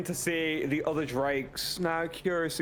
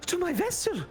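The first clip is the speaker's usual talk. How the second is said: mother's voice; 9 semitones higher than usual. Acted. Mother answers her daughter from the fire, high and lofty. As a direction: speak as a lofty bound jinn, high.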